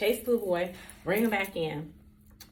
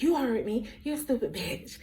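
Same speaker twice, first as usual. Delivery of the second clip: ordinary talk, nothing marked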